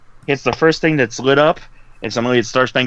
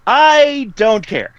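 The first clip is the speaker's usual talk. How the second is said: high, slow holler; shouted character speech; 9 semitones higher, 3 dB louder, much slower than usual. Acted. Player copying another character's speech, high drawn-out holler. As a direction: stretch each word up high. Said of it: "following his speech rather than that".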